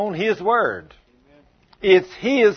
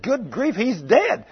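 higher-pitched acted shock; alarmed bystander; 6 semitones higher, slower than usual. Everybody sees the boy thrown down, crying out alarmed. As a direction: blurt it out in mock alarm.

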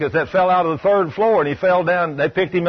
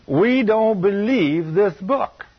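"We don't believe this book" dragged out slowly and higher; slow emphatic repeat; 4 semitones higher, much slower than usual. Real, not acted. Preacher repeating the charge to the church, slow and pointed. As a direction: lean on every word, much slower.

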